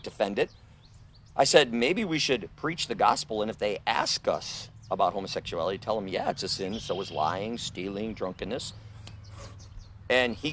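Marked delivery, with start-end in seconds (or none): 1.7-8.7 quiet private word. Retold or imitated. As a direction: say it low so only he hears. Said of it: "I didn't yell it out".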